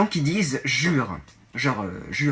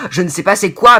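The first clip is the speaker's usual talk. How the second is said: "je ne sais pas c'est quoi" pushed much louder; wrong form, louder; 3 semitones higher, 10 dB louder than usual. Shown as a demonstration. Showing viewers the phrasing they must not say, loudly. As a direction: blurt the wrong phrasing with annoyance. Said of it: "mais on dit pas".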